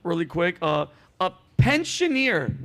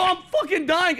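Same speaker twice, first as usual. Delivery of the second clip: strained cry; 10 semitones higher than usual. Retold or imitated. Stuck under the weight.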